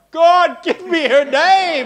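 high, loud, slow plea, half laughing; hammed-up panicked prayer with a laugh; desperate mock cry to God. Pastor at a funeral begging God for the name, loud comic plea. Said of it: "but under my breath, I was like".

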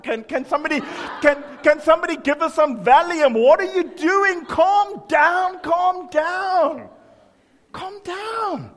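high, loud mock shouting, building to calm down; hammed-up panicked outrage. Preacher mimics churchgoer yelling at weeping woman, hammed-up frantic shout.

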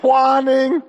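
loud, sustained imitation of a whiny little one; imitated whining. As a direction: stretch it out like a whining child. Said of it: "my other little one is, is whining".

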